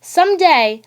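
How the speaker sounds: usual speaking voice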